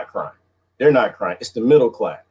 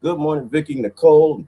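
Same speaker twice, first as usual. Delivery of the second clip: normal talking voice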